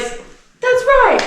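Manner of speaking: put-on high, loud excited little boy; kid quizmaster. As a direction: cheer it like a small host. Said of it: "He's like".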